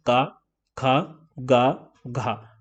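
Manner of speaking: का, खा, गा, घा each said clearly from the throat; demo sounds. Shown as a demonstration. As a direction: separate demo syllables का खा गा घा, voiced in the throat. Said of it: "गले से ही सारे वर्ण निकल रहे हैं". (कंठ से स्पष्ट उच्चारण: का, खा, गा, घा)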